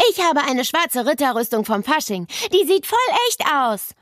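high-pitched boastful schoolboy bellow; boy's excited yell. A narrator's reading. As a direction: bellow it proudly over the schoolyard din. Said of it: "brüllte Basti aus Neles Klasse".

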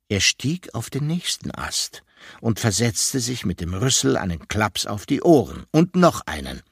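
usual speaking voice

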